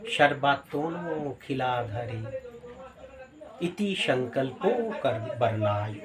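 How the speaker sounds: slow, measured Sanskrit verse chant; শ্লোক পাঠের সুরে ধীরে; scripture recitation. Acted. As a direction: intone the verse slowly like scripture. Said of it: "বলছে".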